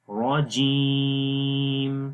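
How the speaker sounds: very slow, stretch the vowel of جیم long; elongated jeem. Shown as a demonstration. Teacher stretching the vowel for students. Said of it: "یہاں پر جیم کو لمبا کریں گے".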